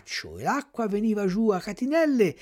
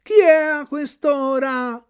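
slow, higher sleepy snail asking who's there; lightly voiced snail; 8 semitones higher, 4 dB louder, slower than usual. A narrator's reading. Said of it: "la quale disse". (lumaca lenta e assonnata, voce appena più acuta)

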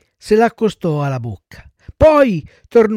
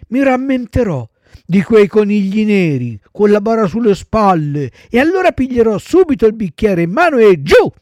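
put-on raised, loud little-boy promise; eager child puppet; 5 semitones higher, 6 dB louder than usual. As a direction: vow it with childish gusto. Voices Pinocchio promising to behave. (burattino volenteroso, voce acuta)

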